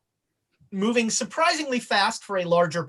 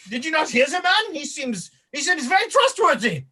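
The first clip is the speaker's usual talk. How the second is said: higher-pitched put-on voice; mock indignant bystander; 7 semitones higher than usual. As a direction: play a bystander chiding the others, pitched high. Acting a bystander answering the panic.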